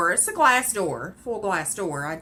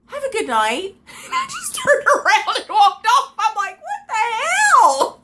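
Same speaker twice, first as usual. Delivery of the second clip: laughing, high and loud; high laughing voice, drawn out at the end; 14 semitones higher, 8 dB louder, slower than usual. Real, not acted. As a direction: crack up while telling it. Laughing while retelling.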